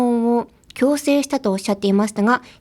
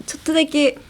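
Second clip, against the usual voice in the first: ordinary delivery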